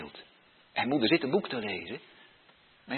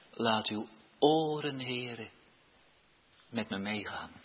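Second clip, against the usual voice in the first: soft, humble prayer voice; modelled prayer; 5 semitones lower, 5 dB quieter than usual. Shown as a demonstration. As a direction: pray it quietly, like a child each morning. (zacht, nederig gebed)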